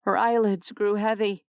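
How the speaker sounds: ordinary delivery, nothing marked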